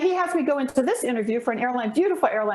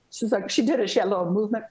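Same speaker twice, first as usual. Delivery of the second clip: normal talking voice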